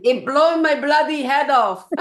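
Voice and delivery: loud, high mimicry of a Romanian accent; punchy mock-Romanian accent; imitated Romanian accent. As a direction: playfully exaggerate the Romanian accent, voice lifted and loud. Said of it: "I love the way you say".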